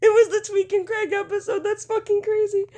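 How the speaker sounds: high, loud, teary laughing voice; crying with laughter. Real, not acted. Breaking down laughing over the South Park comparison, high and teary. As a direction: laugh until you're near tears. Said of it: "I'm gonna start crying".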